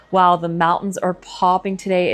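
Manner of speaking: normal talking voice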